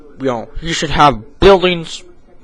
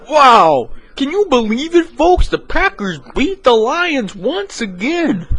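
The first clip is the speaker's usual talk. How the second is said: a high, slow sportscaster; high slow announcer; 11 semitones higher than usual. Acted. Announcer opening the Thanksgiving broadcast, high and slow. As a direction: announce the matchup high and drawn out.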